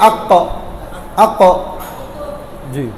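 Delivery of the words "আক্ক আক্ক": আক্ক with the k hit and bounced back briefly; bouncing echo stop. Shown as a demonstration. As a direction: stop hard on the k of আক্ক, then release it. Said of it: "বাড়ির আওয়াজটা ফেরত আসে".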